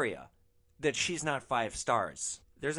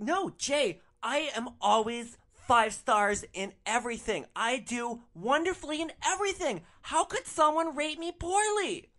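much higher, whiny, outraged mock voice; mock spoiled girlfriend; 12 semitones higher, 5 dB louder than usual. Driver imitating Natalie pleading to her boyfriend Jay, high whiny mock outrage. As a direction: squeal it like a spoiled rich girl in disbelief.

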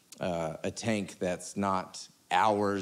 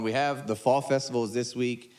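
normal talking voice

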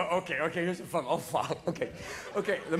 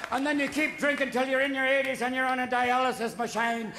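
normal talking voice

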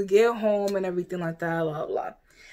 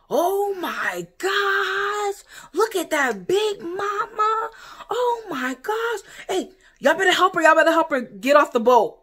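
mocking gawking crowd; 9 semitones higher, slower than usual. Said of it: "And everyone's like".

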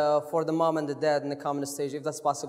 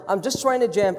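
ordinary talk, nothing marked

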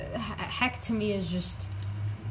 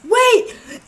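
high, loud yelp of alarm; sudden high yell; alarmed shout; 13 semitones higher, 11 dB louder than usual. Real, not acted. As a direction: cry out on realising the prank. Realises chat pranked him.

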